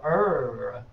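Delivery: "er" held long as one R-coloured vowel; R sound shown. Shown as a demonstration. Teacher isolates a sound missing from native tongue, "er" drawn out. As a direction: hold "er" slowly, tongue curled into the R. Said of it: "does not have the sound of".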